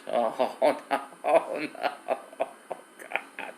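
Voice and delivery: real laughing fit, words dissolving into breath; helpless laughter. Real, not acted. Streamer remembering Caesar's antics, helplessly laughing. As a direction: laugh until the voice gives out.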